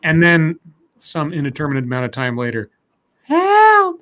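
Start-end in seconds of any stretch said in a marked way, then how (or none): 3.2-4.0 very high, loud falsetto wail; imitated cry for help. The screamer starts up as he dozes, imitated high wail. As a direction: let out a long high scream.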